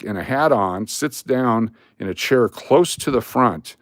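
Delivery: ordinary talk, nothing marked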